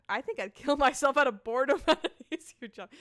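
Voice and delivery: loud, laughing read; laughing through it. Acted. Character joking about boredom, laughing through it. Cracks up reading character line.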